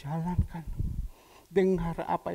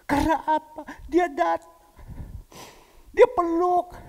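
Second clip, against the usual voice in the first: much higher and louder, building; impassioned higher, louder, building preaching; preaching crescendo; 11 semitones higher, 9 dB louder than usual. Real, not acted. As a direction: build the story to a climax. Preacher climax, rising.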